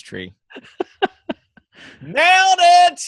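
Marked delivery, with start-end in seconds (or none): 0.6-3.0 laughing squeal. Real, not acted. Jokes about adding Christmas tree.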